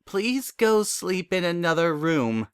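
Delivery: slow, hesitant, a touch of fear; slow and afraid. Acted. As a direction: ask him carefully, a little scared. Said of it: "Twilight said slowly, sounding a little afraid".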